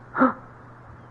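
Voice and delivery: sharp unvoiced gasp of shock; real gasp. Real, not acted. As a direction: catch your breath in surprise. Streamer reacts to the game scene.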